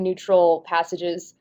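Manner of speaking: ordinary talk, nothing marked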